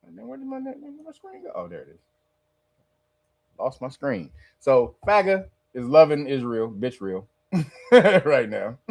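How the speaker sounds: ordinary delivery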